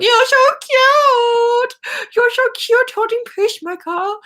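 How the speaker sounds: high, slow cooing voice. Retold or imitated. Gushing over her cuteness with Peacemaker, a high slow coo. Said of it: "I'm like".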